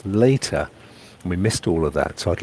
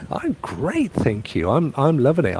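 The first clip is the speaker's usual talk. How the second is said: ordinary delivery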